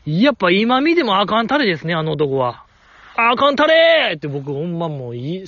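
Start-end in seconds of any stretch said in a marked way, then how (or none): 3.2-4.2 drawn-out yell, imitating an outburst; reenacted shout. Fan shouting at the man on screen, reenacted yell. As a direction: cry it out like heckling a screen. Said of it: "叫びましたもん".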